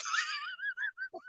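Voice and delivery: high, soft wheezy laugh. Real, not acted. Angler laughing off getting emotional, high wheezy laugh. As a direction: break into a thin high laugh.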